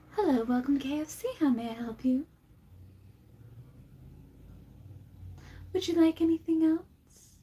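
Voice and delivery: soft, bright fast-food cashier voice; customer service voice. Shown as a demonstration. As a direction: greet the customer politely over the mic. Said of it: "That is my customer service voice".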